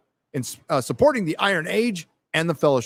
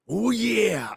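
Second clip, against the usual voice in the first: lightly voiced, higher wrestler oh yeah; a quick nod to Randy Savage; Macho Man impression; 7 semitones higher than usual. Streamer missing Macho Man, light impression. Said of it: "I miss Macho Man".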